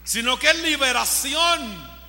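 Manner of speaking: loud, emphatic preaching cry; forceful proclamation. Real, not acted. Preacher declaring it is liberation, proclaiming loudly. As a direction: proclaim it loudly, stretching the key word.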